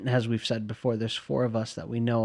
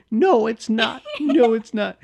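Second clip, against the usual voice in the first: high, louder imitation of a little brother; little-kid protest with a hint of Boston; little baby voice; 11 semitones higher, 5 dB louder, slower than usual. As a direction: pitch it right up like a protesting kid. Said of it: "like, a little baby voice".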